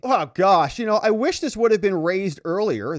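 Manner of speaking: hammed-up complaining; mock whining. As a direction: whine it like a fed-up judge. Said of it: "the judge starts whining".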